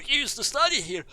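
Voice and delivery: high-pitched burst of discovery; excited realisation. Real, not acted. Player working out Sinclair's past, excited and high. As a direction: blurt it out high, amazed. Sudden realisation while playing.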